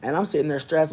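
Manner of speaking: ordinary delivery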